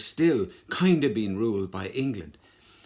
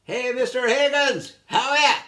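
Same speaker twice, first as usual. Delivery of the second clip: much higher, loud sing-song hail; mock friendly call; 12 semitones higher, 7 dB louder than usual. Rebel greets Higgins' father before shooting, loud and mocking.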